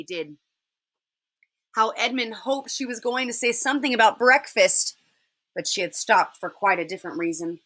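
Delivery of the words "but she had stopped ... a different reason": plain reading voice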